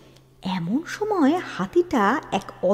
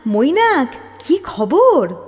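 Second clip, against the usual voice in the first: loud, higher call to the elephant; 6 semitones higher, 7 dB louder, slower than usual. Acted. King Shashanka loudly hails his elephant Mainak, calling out. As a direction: hail the elephant warmly across the night.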